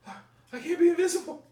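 very high, loud panicked wail; mock losing it. Imitating the magician's fooled victim, shrieky mock panic. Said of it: "he was losing his shit".

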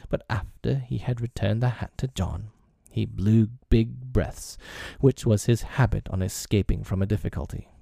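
usual speaking voice

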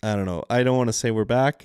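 ordinary talk, nothing marked

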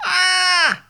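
high, drawn-out cry of exasperation; frustrated "Ah!"; exasperated cry. Acted. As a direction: let out a high cry as patience snaps. Leader's patience snaps.

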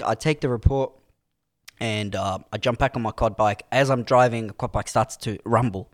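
ordinary delivery

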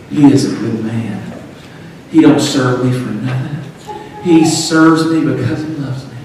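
a hint of God speaking, low and measured. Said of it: "Hear the voice of God concerning Job".

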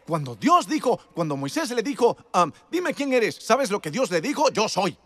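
loud, high preacher's cry; full-force preaching. Acted. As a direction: preach it out to the crowd.

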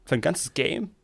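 high, whiny fan complaint; complaining fans. Retold or imitated. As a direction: whine it like annoyed fans. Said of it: "bei viertausend alle Leute".

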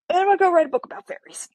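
very high, quick sing-out; giddy add-on. Retold or imitated. Patient tacking fairy book onto medical plans, giddily. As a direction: toss it in fast and way up high.

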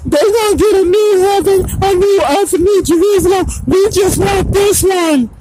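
mock them as crybabies, wailing; hammed-up sobbing complaint; mock crying. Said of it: "So Islam crying".

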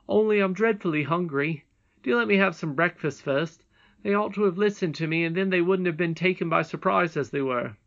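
a hint of boyish protest; hungry boy. A narrator's reading. Curdie asking his mother for breakfast, mild protest.

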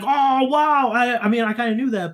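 unimpressed sarcastic wow; sarcastic fake surprise. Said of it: "you're like".

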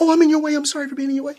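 high, meek over-apologising voice; imitating a timid shopper saying sorry; mock apologetic shopper. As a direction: squeak out an anxious apology.

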